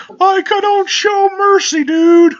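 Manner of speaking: very high, slow, squawking slacker voice; loud cartoon refusal, hammed up; Shaggy. Shaggy refusing his victim mercy, cartoon impression. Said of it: "doing the Shaggy voice is fun".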